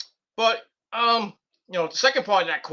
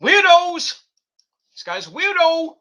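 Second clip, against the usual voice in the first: loud, much higher mocking yell; yelling weirdos; 11 semitones higher, 7 dB louder, slower than usual. Real, not acted. Host yelling at a commenter he thinks is strange, loud and high.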